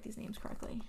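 embarrassed whisper to herself. Real, not acted. Caught mixing up names, she whispers to herself. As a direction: mutter it under her breath, ashamed of the mistake.

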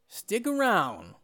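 slow sign-off. Acted. Quoting daddy, slow sign-off.

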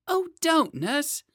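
put-on high, squirming child protest; a wriggling boy pushing the nurse away; whiny small boy.

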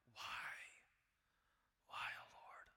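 anguished whisper to God; hushed desperate whisper; whispered cry. Acted. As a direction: breathe the plea out, barely voiced. Preacher voicing anguished cry.

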